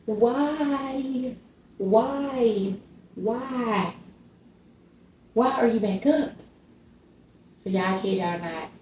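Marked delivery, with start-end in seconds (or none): 0.0-6.3 put-on higher, pleading whys to the baby; weary exasperation. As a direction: beg the baby to just sleep. Baby wakes again at night.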